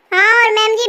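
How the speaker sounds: ordinary talk, nothing marked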